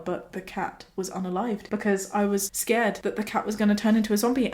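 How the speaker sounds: ordinary delivery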